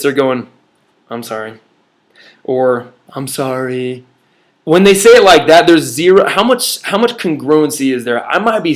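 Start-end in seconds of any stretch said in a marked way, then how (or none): 1.1-1.6 mumbled, much softer half-hearted sorry; insincere sorry. Shown as a demonstration. Sibling forced to apologize, mumbling it. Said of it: "they say it like this". 3.1-4.0 sulky, softer put-on apology; grudging sorry. Shown as a demonstration. Sister forced to say sorry, sulking. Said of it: "they say it like this".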